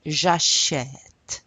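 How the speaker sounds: "j'achète" slowly, with an open "eh" in the second syllable; open è sound. Shown as a demonstration. Teacher showing the accent grave, "j'achète" with open "eh". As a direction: model "j'achète" carefully, stretching the "eh" before the T. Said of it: "So this is pronounced".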